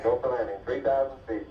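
ordinary delivery

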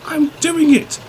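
high, loud straining effort; huffing. A narrator's reading. Derek hauling Kings and trucks, huffing with effort. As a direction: push the words out under a heavy load. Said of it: "Derek huffed".

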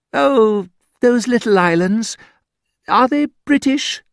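a girl pretending not to know geography; mock English girl. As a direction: play a naive English girl asking sweetly. Voicing an English girl's question.

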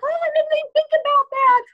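very high, loud mock realization; imitated mother. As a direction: play mom suddenly catching on. Acting mother's sudden realization.

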